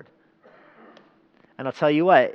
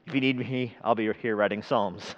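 soft, lower, offhand aside to God; David's understated prayer; 4 semitones lower, 7 dB quieter than usual. Acted. David praying to God amid trials, quietly understated. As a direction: drop it down softly, leaving it with God. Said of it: "David's prayers often go like this".

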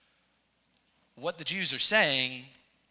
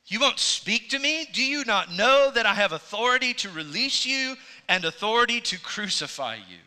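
high, louder taunting challenge; provoking; 7 semitones higher, 5 dB louder than usual. Retold or imitated. Pilate taunting Jesus about his authority, retold provoking challenge. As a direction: needle him to get a reaction. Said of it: "just trying to provoke some kind of response".

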